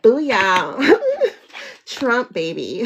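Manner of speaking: loud, high hammed-up booyah. Teasing the card player, a loud hammed-up caricature. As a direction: ham up a caricature. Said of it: "I turned it up".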